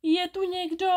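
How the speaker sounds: high-pitched anxious call. A narrator's reading. Woman calling out to whoever broke her window, high-pitched. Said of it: "paní Kadrnošková začala volat".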